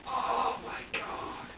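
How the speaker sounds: long, breathy, drawn-out exhale of dismay; overwhelmed. Retold or imitated. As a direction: let it out slowly, almost voiceless. Retells being overwhelmed by baby's screaming.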